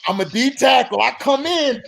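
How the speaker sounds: ordinary delivery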